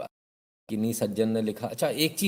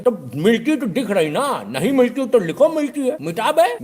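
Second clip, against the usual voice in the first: much higher and louder, slow to a yell; escalating mock rant; comic shout; 11 semitones higher, 7 dB louder, slower than usual. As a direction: build into a bossy yell. Know-it-all orders word removed.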